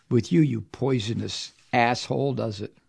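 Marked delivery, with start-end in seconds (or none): none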